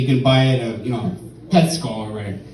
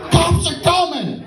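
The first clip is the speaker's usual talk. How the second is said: high-pitched mock yell; alarmed warning. Acts warning that police come.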